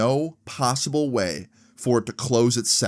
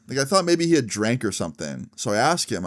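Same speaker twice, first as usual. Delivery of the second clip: plain reading voice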